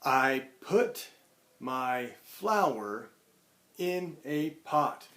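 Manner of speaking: each word drawn out slowly; slow dictation. Shown as a demonstration. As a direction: dictate it slowly to young kids. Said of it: "So I can say".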